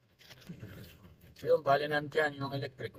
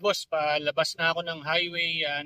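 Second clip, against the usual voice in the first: ordinary talk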